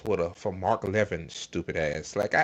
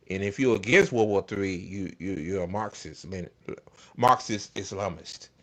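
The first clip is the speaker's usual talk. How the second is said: ordinary talk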